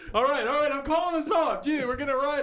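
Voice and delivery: laughing shout. Real, not acted. Host ends the segment laughing.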